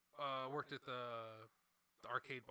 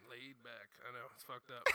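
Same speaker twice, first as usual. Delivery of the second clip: ordinary delivery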